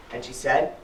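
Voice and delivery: ordinary delivery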